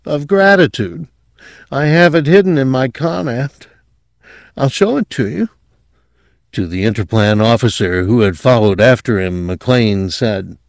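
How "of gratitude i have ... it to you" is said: lightly dazed patient, high voice; a touch of sedated reverie, pitched high; drugged mumble. A narrator's reading. Drugged patient offers to show a scroll, lightly dazed and high. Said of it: "Quayle mumbled".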